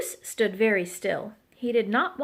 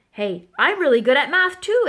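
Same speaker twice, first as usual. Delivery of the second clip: loud, higher bragging; eager exclamation; 4 semitones higher, 8 dB louder than usual. A narrator's reading. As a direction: exclaim it, showing off. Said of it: "exclaimed Thistle".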